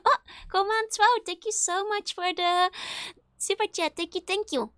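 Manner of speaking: high, loud, delighted thanks; excited thanks. Real, not acted. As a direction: gush your thanks to the viewer. Thanking for a super chat.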